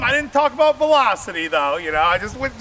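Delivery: ordinary delivery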